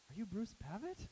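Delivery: high, eager question; eager fan. Retold or imitated. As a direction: ask like a starstruck fan. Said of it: "is like".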